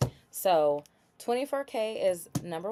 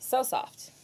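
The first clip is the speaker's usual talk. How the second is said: higher, voiced swoon over it; delighted exclaim; 7 semitones higher than usual. Real, not acted. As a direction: sing it out, delighted. Repeats aloud after whisper.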